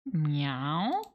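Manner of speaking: low, questioning kitty meow; a curious cat asking. Pretend. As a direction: mew it like a puzzled cat. Voicing the cat from game text.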